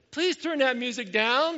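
high, loud, drawn-out mock-polite plea; insistent polite request. Pastor telling his version of asking the neighbors, insistent polite request.

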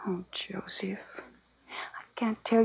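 hushed, breathy secret confession; urgent whisper. Acted. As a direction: whisper so the guards can't hear. Secret lovers alone with captive.

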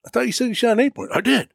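surprised. Retold or imitated. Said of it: "I said".

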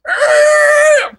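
sudden high, loud pirate-like roar; giant's roar. Retold or imitated. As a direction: roar it out like a bully charging. Giant yells charging at kid.

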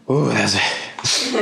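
a real high laugh, slightly choked; teary laugh. Real, not acted. Interviewer moved to tears by the guest's story, a real teary laugh. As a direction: laugh it off while tearing up.

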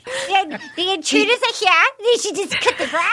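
put-on high old-timey reporter-like Australian; botched Australian accent. Shown as a demonstration. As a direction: badly fake an Aussie, like an old newsman. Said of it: "sound like an old-timey, like, newspaper reporter".

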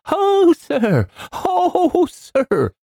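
very high housekeeper's shriek; piercing scream. A narrator's reading. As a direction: wail it in panic at the top of the voice. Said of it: "a piercing scream".

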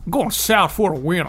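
put-on comically off-target Kansas accent; badly faked Kansas accent; failed Kansas accent. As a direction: miss the Kansas accent entirely. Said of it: "Not Kansas".

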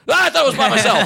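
high, fast startled squeal; mock startled yelp. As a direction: jump out of your skin, hammed-up. Scared guy imitated.

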